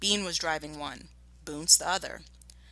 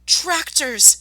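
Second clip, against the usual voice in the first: much higher, loud alarmed shout; 10 semitones higher, 10 dB louder than usual. Acted. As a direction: yell the warning. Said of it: "shouted Mister Fox".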